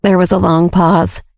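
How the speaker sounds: ordinary delivery, nothing marked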